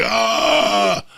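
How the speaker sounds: loud, high startled "ah"; shocked shout. Retold or imitated. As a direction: yell out in shock. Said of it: "I was like".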